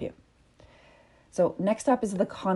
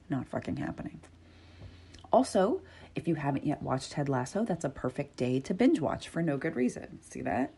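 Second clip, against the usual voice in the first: ordinary delivery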